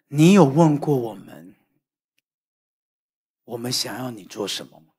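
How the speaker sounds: wife quietly answering the shouting husband, whispered; soft wife's voice. Retold or imitated. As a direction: gently tell a hard truth, barely voiced. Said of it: "我太太说".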